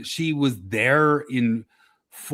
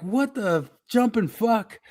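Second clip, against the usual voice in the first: incredulous; 8 semitones higher, slower than usual. Retold or imitated. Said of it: "the whole entire thing is like".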